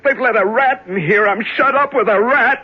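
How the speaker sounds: high, frantic alarm at a rat; panicked cry. Acted. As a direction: shriek it out in terror. Man shut in dark with rat.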